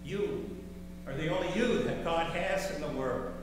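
ordinary delivery

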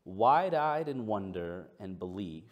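slow, measured reading with long pauses; scripture reading. Acted. Pastor opens his sermon with a passage, slow reading. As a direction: read it out deliberately, phrase by phrase.

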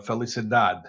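Spanish word in an American accent. Real, not acted. Speaker explaining an etymology to Spanish speakers, American accent. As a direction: say the Spanish low, with American vowels. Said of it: "Please don't judge my terrible American accent".

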